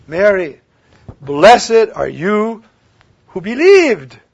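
loud, slow, rising proclamation; a slow, loud beatitude; proclaimed blessing. Acted. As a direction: bless her with full voice.

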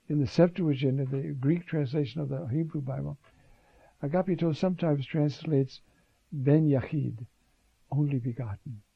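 usual speaking voice

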